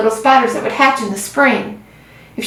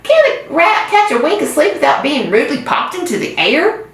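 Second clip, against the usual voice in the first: grumpy rat; 7 semitones higher than usual. A narrator's reading. Rat grumbles after rude awakening.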